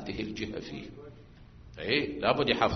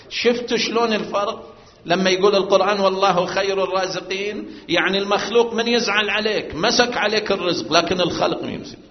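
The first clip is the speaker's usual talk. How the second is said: raised, louder preacher's voice; preaching at full force; 7 semitones higher, 8 dB louder than usual. Acted. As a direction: drive the point home to the congregation.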